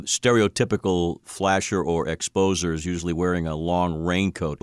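ordinary talk

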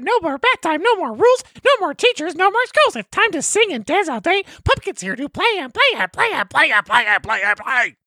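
very high squeaky puppet voice; sing-song creepy puppet chant; Pumpkin voice. Acted. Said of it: "you have a great pumpkin voice".

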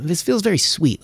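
ordinary delivery

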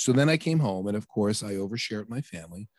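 ordinary talk, nothing marked

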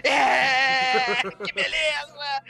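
excited cheer. Retold or imitated. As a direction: cheer like you won the lottery. El Santo delighted, won the lottery.

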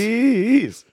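high squeaky echo. Pretend. Echoing nickname higher.

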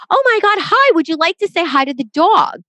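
over-the-top eager dog trainer; such enthusiasm. Retold or imitated. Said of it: "says with such enthusiasm".

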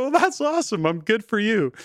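high, lilting praise; retold cheering on. Adult child congratulating mom on bowling, retold high-pitched praise.

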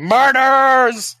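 loud, high drawn-out scream; high wailed shout, stretched long. Acted. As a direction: scream it out as an accusation.

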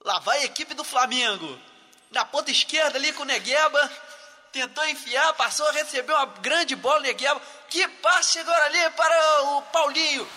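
play-by-play narration. Pretend. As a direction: narrate the attack live. S0 mock-narrates Flamengo goal.

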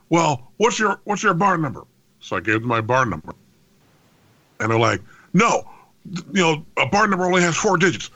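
a hint of a brusque foreign caller; imitating the caller. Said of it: "clearly was not born domestically".

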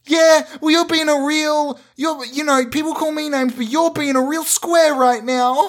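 put-on high whiny teenage son back-talking his father; sulky teenage son. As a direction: talk back to your dad like a sulky kid. Acted teen son arguing with dad.

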